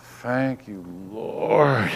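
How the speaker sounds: soft, low sigh of gratitude; relieved thanks. Retold or imitated. Preacher retelling his relief at doctor's apology, hushed thanks. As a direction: breathe out a quiet prayer of relief.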